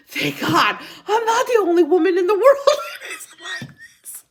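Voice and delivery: loud, high wail of relief; overjoyed relief dissolving into laughs; laughing relief. Real, not acted. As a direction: cry it out through laughter. Relieved that listeners share her problem.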